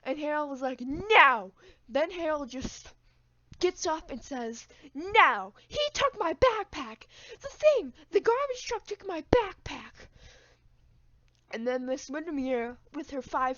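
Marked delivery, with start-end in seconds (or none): 0.8-1.5 loud defiant no. Retold or imitated. Harold refusing. 4.9-10.1 higher, loud whiny protest; upset kid. Retold or imitated. Harold protesting to the teacher, high and loud.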